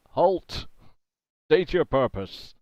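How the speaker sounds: loud, broadcast metallic yell; metallic voice filter. Acted. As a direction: bark it through a loudspeaker. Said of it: "broadcast metallic voice yell out".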